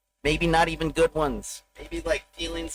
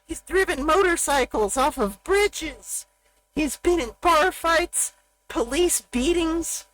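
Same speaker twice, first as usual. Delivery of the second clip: put-on high, slow, wide-eyed kid voice; childhood awe; 11 semitones higher than usual. Recalling his father as a child, in high awestruck kid voice. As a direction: marvel like a boy in awe of his dad. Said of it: "I thought when I was a child".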